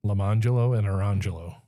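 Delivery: Lamangelo and Orangelo with the weight on MON and RON; unexpected name pronunciation. Shown as a demonstration. Repeating the twins' names on request, Lamangelo and Orangelo stressed mid-word. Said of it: "what were the Jellos again".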